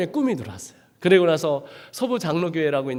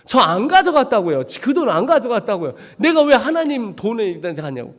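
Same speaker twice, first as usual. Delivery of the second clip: higher and louder denial, with a laugh; indignant protest; 4 semitones higher, 4 dB louder than usual. Retold or imitated. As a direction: insist hotly that you did not take it. Indignant denial, wrongly accused.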